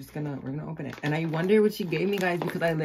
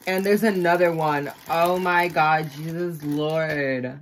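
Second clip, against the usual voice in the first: loud excited; 8 dB louder, slower than usual. Real, not acted. Another gift found.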